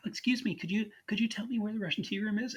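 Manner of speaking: high, soft put-on lady's voice; a man playing a genteel lady, asking the way; Dorothy's high voice. Man in disguise asks directions in a high voice. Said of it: "as Dorothy, and he's like".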